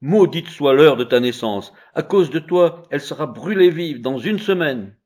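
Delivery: loud reproachful scolding; scolding women. Acted. Women berating the laughing child, loud reproachful scolding. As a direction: curse his birth with reproach.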